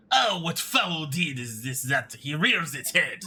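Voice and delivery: put-on slow, theatrical French accent. French servant decrying a foul deed, theatrical French accent. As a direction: lament grandly in a French accent. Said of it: "I hate that he's French".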